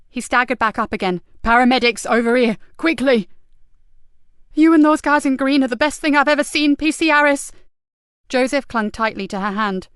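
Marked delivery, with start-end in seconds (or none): 1.4-3.3 loud urgent call. Acted. Calling paramedics urgently. 4.5-7.5 high, loud relief; officer crying out in relief; relieved cry. Acted.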